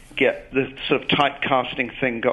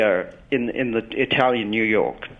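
ordinary delivery

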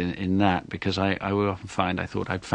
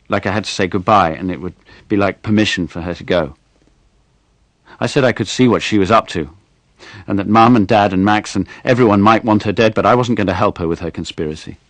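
put-on clear, expressive reading of a boy's story; 8 dB louder than usual. A narrator's reading. Actor reading his short story on air, expressive storytelling. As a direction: read it aloud for a radio audience.

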